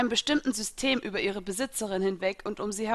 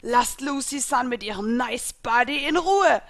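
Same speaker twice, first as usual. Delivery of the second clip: raised shout building to the end; loud, higher warning call; monstrous spirit calling; 4 semitones higher, 5 dB louder than usual. A narrator's reading. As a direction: bellow it protectively as a big spirit. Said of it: "rief der monströse Geist".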